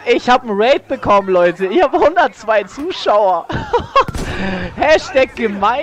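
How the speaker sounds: loud, thrilled announcement; can't believe the viewer count; excited. Real, not acted. Streamer overwhelmed by raid. (aufgeregt und laut)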